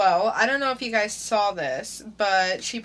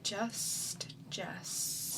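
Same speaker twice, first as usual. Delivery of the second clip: whisper; 11 dB quieter than usual. Pretend. Mock whisper of her nickname.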